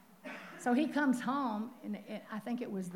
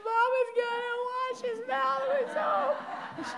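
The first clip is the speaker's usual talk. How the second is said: put-on high, slow little-girl voice, laughing through it; frightened little girl; 5 dB louder, slower than usual. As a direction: play the scared little sister, half laughing. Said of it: "She said".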